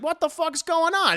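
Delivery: high startled query; alarmed question. Retold or imitated. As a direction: ask it, spooked in the dark. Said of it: "He's like".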